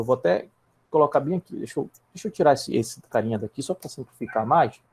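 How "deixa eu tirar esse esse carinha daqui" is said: ordinary delivery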